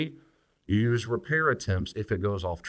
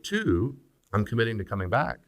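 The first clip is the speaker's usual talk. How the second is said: normal talking voice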